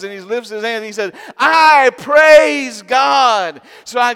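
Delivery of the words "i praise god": loud, high, very slow proclamation; shouted praise. Retold or imitated. Old man shouting his praise to God. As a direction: lift your hands and shout it out. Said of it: "shouting at the top of his lungs".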